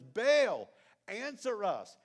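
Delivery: "Baal" flung out loud and high; desperate shout. Retold or imitated. Prophets of Baal crying to their god, loud shout. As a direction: cry out to Baal, loud and pleading. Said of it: "They shouted".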